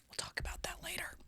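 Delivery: quick hushed aside; teasing aside to listeners; whisper. Real, not acted. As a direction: drop to a whisper.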